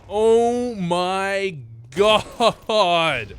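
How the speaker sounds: very slow, loud drawn-out gasp; stretched loud oh my God; stunned. Real, not acted. Reacting to the round.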